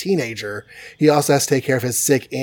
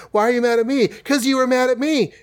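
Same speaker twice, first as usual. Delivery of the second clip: much higher bickering voices; squabbling teens; 10 semitones higher than usual. Retold or imitated. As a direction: pitch way up for a petty teen spat. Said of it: "She's like".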